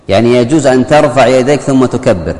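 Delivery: normal talking voice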